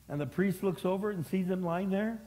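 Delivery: ordinary delivery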